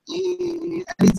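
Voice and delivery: high, slow, stretched-out whine; mock reluctant whine. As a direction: whine it out like you're dodging the question. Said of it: "I'm like".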